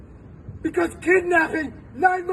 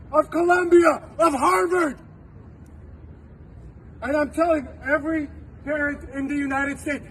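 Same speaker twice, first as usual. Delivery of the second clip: ordinary talk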